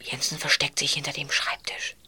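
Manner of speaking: hushed whisper so nobody hears. Acted. Boy whispering to his friend while spying. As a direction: breathe it out, hiding from Jensen.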